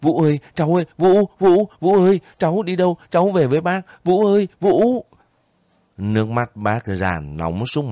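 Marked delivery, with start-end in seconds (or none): 0.0-5.0 much higher, louder frantic cries of his name; frantic calling. A narrator's reading. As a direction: call him back in panic, voice climbing. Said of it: "rối rít". (bác gái gọi Vũ bất tỉnh, rối rít kêu to)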